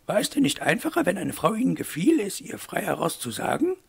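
high-pitched, lilting lady's voice; questioning mademoiselle. A narrator's reading. Eloire asks Wolf why not speak frankly, high lady voice. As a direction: press the question in a high character voice.

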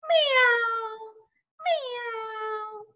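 high squeaky kitty meow; cat meow. Acted. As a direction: mew like a little cat. Cat sound, far high voice.

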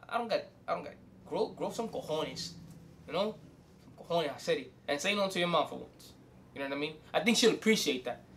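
usual speaking voice

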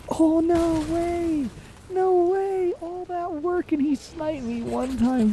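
high, slow whining disbelief; real whiny despair; anguished whine. Real, not acted. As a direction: wail about the lost work. Sniped after all that work.

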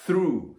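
through with the tongue lightly between the teeth on th; English through. Shown as a demonstration. Teacher showing students, careful English through. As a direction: through said with a bitten-tongue th and a rolled ru. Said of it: "私もあんま上手くない".

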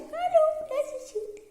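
put-on high falsetto girly hello; girl voice. Shown as a demonstration. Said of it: "Kız sesi çıkaracağım". (kadın sesi taklidi)

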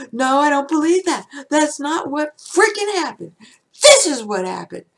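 loud, high, indignant protest; a furious insistence; angry outburst. Shown as a demonstration. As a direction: shout it like you're fed up. Acted angry self-talk example.

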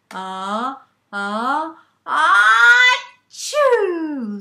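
ah-ah-ah climbing to a loud sneeze; sneeze buildup. Acted. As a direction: build the sneeze, then release it. Frog sneezes in the song.

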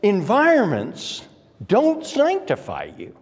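high, ringing pulpit delivery; impassioned preaching. Acted. Preaches at full pitch.

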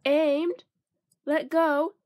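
ordinary delivery

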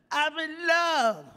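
put-on high, slow, lovesick girl's voice; mock a weepy young girl. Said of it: "She said".

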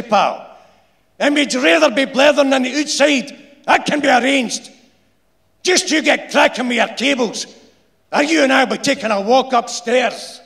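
high, loud threatening Glaswegian; angry foreman. A narrator's reading. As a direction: threaten him, barely holding it in. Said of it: "scarcely contain his anger".

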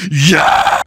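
loud high yell of triumph; triumphant shout. Real, not acted. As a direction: shout it out in victory. Evoker finally attacks.

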